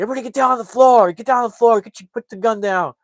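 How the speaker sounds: fast, high, loud screamed commands; screaming orders. Retold or imitated. Cops scream at each other, fast high screaming. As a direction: scream them down to the floor. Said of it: "They're just screaming at each other".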